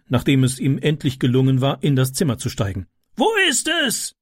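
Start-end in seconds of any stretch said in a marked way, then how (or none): 3.1-4.1 very high-pitched urgent question; calling down. A narrator's reading. Neighbour asks where the child's bed is, high call. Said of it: "rief er nach unten".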